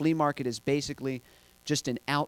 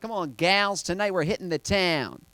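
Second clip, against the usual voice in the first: higher voice building to a shout; rallying mom; 7 semitones higher, 9 dB louder than usual. Retold or imitated. Imitated mom to her friends before a night out, rousing cheer. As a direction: hype up the gals, ending loud and high.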